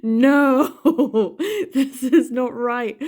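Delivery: a hammed-up laughing howl of outrage; mock anguished cry. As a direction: cry out like a wounded Scottish soul, half laughing. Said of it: "my Scottish soul is crying out".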